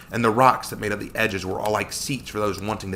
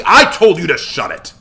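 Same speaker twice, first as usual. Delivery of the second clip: loud, raised-pitch rebuke; angry snap; 8 semitones higher, 9 dB louder than usual. A narrator's reading. Joshua cuts off Samuel's pleading, angry snap. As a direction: cut in and snap it loudly. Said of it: "Joshua snapped".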